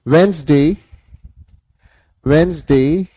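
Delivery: "वेडनेसडे" as WENZ-day, lilting up at the end; silent D. Shown as a demonstration. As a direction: model "वेडनेसडे" for a class, no D before the N. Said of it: "इसमें डी का प्रयोग नहीं होगा".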